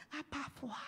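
soft, barely voiced call to the Father; quiet prayer. Shown as a demonstration. Pastor shows a tired believer's prayer, softly. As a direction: pray it very softly. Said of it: "你真的常常".

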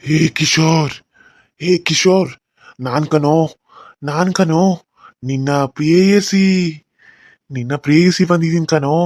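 loud eerie ghost call. Acted. Ghost lover announcing herself to Kishore, loud and eerie.

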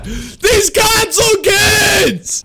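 very slow, high, drawn-out yell; furious shout. Real, not acted. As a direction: stretch out your outrage at them. Raging at the opposing players.

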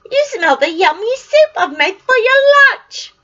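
high, loud sing-song wife; cheery giant's wife. Acted. Wife distracting the giant from Jack, high and loud. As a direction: cover for him brightly.

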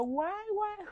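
high mock wail of "guay, guay"; retold cries of pain. After falling off the mountain bike, high imitated wailing. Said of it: "yo estaba allá a los gritos".

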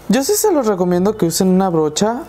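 usual speaking voice

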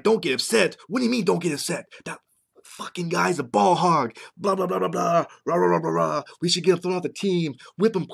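high, agitated mock outburst; angry son. Imagined son ranting at dad about a teammate, angry. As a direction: act out an indignant rant, trailing into blah-blah. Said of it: "gotten even ma-, ad-, uh, angrier".

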